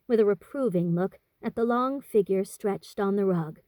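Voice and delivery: plain reading voice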